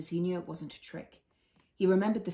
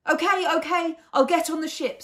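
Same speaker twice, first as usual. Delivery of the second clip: agitated; 10 semitones higher, 9 dB louder than usual. A narrator's reading. Fez obeying BB-8's urgent order.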